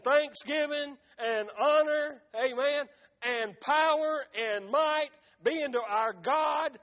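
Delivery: forceful, chant-like reading in slow surging phrases; preached scripture reading. Acted. Preacher reading Revelation to the congregation, forceful proclamation. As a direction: proclaim each phrase with weight.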